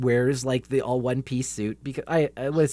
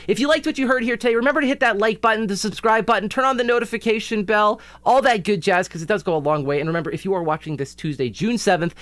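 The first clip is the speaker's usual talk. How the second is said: outro plug; 7 semitones higher than usual. Acted. Channel outro call to action.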